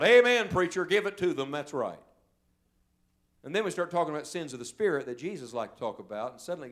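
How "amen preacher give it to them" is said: loud, high cry of amen; shouted amen. Retold or imitated. Congregation member shouting back at the preacher. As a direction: shout it like an eager churchgoer. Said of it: "everybody wants to shout".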